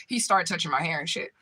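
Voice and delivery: usual speaking voice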